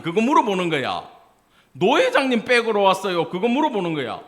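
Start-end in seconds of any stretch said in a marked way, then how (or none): 1.7-3.3 high, loud mock interrogation; imitating a blunt questioner; loud nosy questioning. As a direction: fire it off as a prying question. Mocking nosy questions put to pastors.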